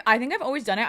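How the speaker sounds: ordinary talk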